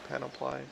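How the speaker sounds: Panoply ending in PLY, like the word apply; another try at panoply. Shown as a demonstration. Streamer tests a second way to say Panoply. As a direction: try Panoply with a long final "eye". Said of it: "I'm not actually sure I pronounced that word".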